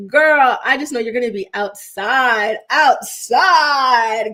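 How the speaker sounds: big excited whoop of encouragement; excited cheering on. Real, not acted. Cheering on viewer's good news.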